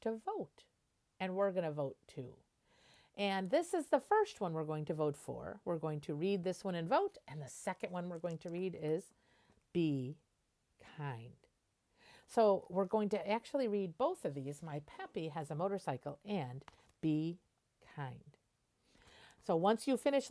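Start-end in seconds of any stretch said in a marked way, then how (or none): none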